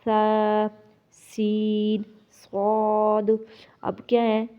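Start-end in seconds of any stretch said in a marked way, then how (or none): 0.1-0.7 سا as a long, soft lisped "tha". Shown as a demonstration. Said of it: "تا کو نرم". 1.1-2.0 سین drawn out with a sharp whistled s; whistling seen. Shown as a demonstration. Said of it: "سین کو سیٹی کی آواز والا". 2.3-3.3 صاد drawn out, thick and rounded; heavy saad. Shown as a demonstration. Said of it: "صاد کو موٹا".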